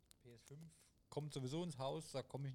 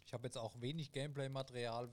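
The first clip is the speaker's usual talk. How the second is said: ordinary talk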